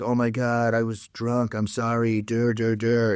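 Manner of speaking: sheepish mock-dumb voice; dopey apology. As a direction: play the excuse like a dope. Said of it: "he's like".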